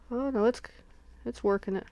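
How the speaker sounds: ordinary talk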